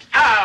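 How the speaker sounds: sharp high yelp of pain; sudden hurt yelp, loud and high. Acted. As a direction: cry out as the neck twinges. Neck muscle hurts on movement.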